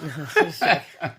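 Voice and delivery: joking through a real laugh. Real, not acted. Woman joking about her turquoise gown, laughing. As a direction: laugh it off as a joke.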